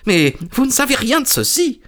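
very high, nervous protest; alarmed little man. Acted. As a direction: object in a high, worried voice.

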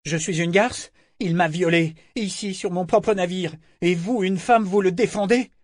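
high, indignant, slightly breathless protest; a touch of panting outrage. A narrator's reading. Althea protests from under Eta's weight, panting. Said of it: "Althea dit en haletant".

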